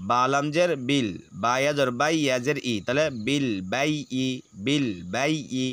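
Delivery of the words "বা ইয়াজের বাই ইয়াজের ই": normal talking voice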